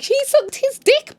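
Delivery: high, drawn-out mock wailing; mock tearful wail. Crying girl tells the family what happened, mock wailing. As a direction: imitate a hysterical tattletale. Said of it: "hears her screaming and crying".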